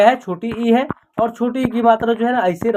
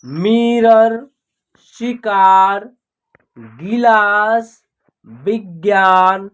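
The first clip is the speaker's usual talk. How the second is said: drawn-out words with long pauses between; slow word drill; much slower than usual. Shown as a demonstration. Teacher modelling words for learners to read, slow drill. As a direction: read each word slowly, stretching the vowels.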